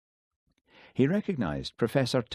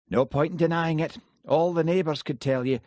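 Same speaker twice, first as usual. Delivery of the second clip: higher, louder defiant Scottish woman; Jessie's voice; 9 semitones higher, 5 dB louder than usual. A narrator's reading. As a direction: give her a blunt, defiant edge.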